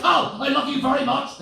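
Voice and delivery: high, loud declaration of love; imitating a frightening, loving wife; scary loving wife. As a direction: make affection sound like a threat. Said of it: "being loving with that voice".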